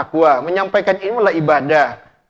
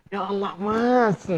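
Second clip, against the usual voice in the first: slow touched sigh; 2 semitones higher, 5 dB quieter, much slower than usual. Retold or imitated. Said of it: "Pasti dia kata".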